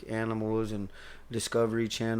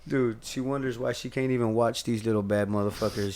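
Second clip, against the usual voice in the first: ordinary talk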